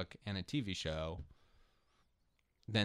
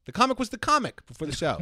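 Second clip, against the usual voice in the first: shouted, fast and high; loud mock rant; 11 semitones higher, 9 dB louder, faster than usual. Shown as a demonstration. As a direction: belt it out like a rant. Repeats quote much louder, faster.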